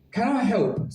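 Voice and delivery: lightly voiced robot greeting; flat mechanical robot voice. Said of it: "said a robotic voice".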